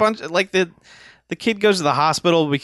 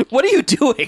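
high confused voice; 11 semitones higher, 4 dB louder than usual. Retold or imitated. Acted quote of confused guy.

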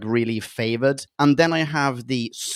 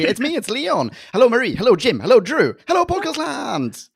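bright, high, bubbly hellos; excited high greeting; 10 semitones higher than usual. Acted. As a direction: greet everyone up high and eager. Leon's greetings, much higher.

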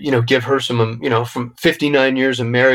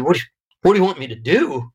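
higher, fast, disbelieving echo; incredulous; 4 semitones higher, faster than usual. Retold or imitated. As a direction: throw the question back in disbelief. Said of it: "And my mom said".

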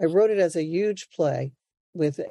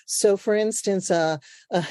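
ordinary talk, nothing marked

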